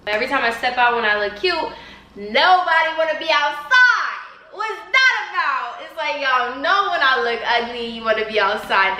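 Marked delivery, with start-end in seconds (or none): 2.1-5.8 mock complaining. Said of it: "I was just like".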